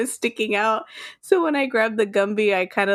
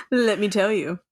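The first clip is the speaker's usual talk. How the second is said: ordinary delivery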